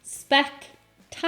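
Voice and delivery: "Spectacular" slowly, syllable by syllable; "Spectacular" pulled apart slowly, each syllable separate; demonstrated pronunciation. Shown as a demonstration. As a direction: break "Spectacular" into spec-tac-u-lar, slowly. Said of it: "I have to say spectacular".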